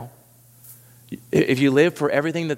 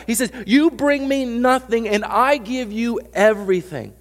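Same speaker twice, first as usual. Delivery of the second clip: impassioned Jesus; 7 semitones higher, 3 dB louder than usual. Acted. Jesus telling the disciples what the kingdom is, building loud. Jesus voiced preaching loudly.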